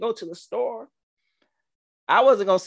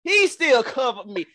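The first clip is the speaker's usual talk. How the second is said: preaching; 12 semitones higher than usual. Acted. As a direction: preach it up to the rafters. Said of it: "Where's the organ?".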